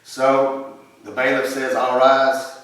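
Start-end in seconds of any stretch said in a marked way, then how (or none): 1.7-2.6 loud courtroom call to rise; bailiff's call. Acted. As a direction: announce it to the whole court.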